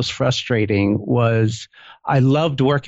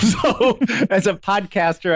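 laughing; 8 semitones higher than usual. Real, not acted. Laughs at own joke.

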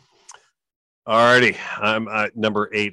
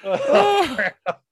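after laughing hard. Real, not acted. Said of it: "Oh, my stomach hurts".

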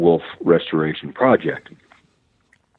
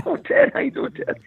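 surprised delight. Retold or imitated. Delighted surprise at Ted calling.

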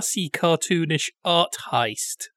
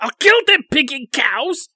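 higher, louder mock yell; imitated angry shouting; 7 semitones higher, 6 dB louder than usual. Quoting Bobby threatening the cows, mock shouting. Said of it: "Bobby shouting at the cows".

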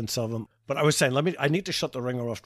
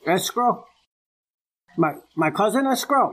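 higher, breaking into laughter; mock confused caller; 7 semitones higher than usual. Pranking the telemarketer, mock confused and giggling. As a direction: play dumb about escrow, giggling.

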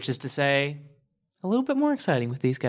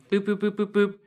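high beeping boops; dialing beeps; 6 semitones higher, faster than usual. Acted. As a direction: beep like phone buttons. Dialing comm device sounds.

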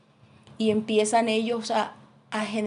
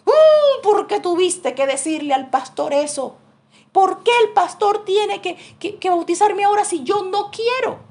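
much higher, loud whiny complaint; whining protest; 10 semitones higher, 7 dB louder than usual. Retold or imitated. Son protesting to his mother about baptism, high loud whine. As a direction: wail it like a grown man throwing a tantrum.

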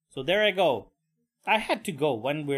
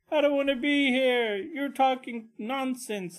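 put-on upset kid voice; 9 semitones higher than usual. Storming out on his dad.

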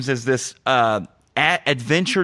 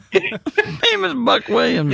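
laughing; 10 semitones higher, slower than usual. Real, not acted. Laughing callback to the phrase.

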